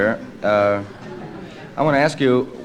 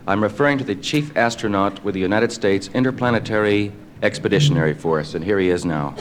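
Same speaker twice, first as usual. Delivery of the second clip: measured, lower announcer delivery; old radio announcer; 4 semitones lower than usual. Acted. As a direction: introduce the guest like a newsreel. Introduces the interview show.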